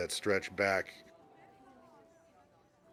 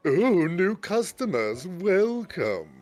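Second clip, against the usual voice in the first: shopkeeper greeting; 10 semitones higher, 6 dB louder, slower than usual. Acted. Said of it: "you hear a guy go".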